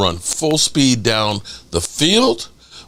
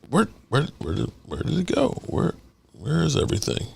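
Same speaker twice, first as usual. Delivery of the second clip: puzzled murmur; 8 dB quieter than usual. Real, not acted. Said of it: "are we still live?".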